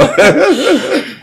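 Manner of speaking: high falling laugh. Real, not acted. Host laughing at how hard it is to talk about oneself. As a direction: laugh out, amused.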